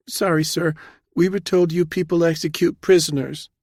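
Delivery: high, apologetic woman's voice; nervous, upset junior officer pitched high; frightened young captain. A narrator's reading. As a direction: confess fearfully to a superior.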